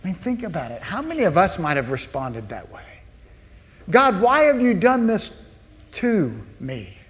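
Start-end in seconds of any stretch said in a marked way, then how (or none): 3.9-7.0 higher, aggrieved why-me; complaining to God. Shown as a demonstration. Believer accusing God in hardship, aggrieved complaint. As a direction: protest as if wronged.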